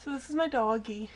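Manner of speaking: usual speaking voice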